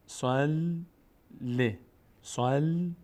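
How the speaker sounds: "صل" intoned high, then a short "ل"; syllable drill. Shown as a demonstration. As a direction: split the doubled lam across "صل، ل". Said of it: "لام تشدید داره".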